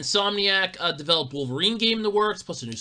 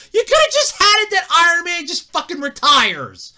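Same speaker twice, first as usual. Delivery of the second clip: heated rant; 11 semitones higher, 8 dB louder than usual. Real, not acted. Ranting about Iron Man death.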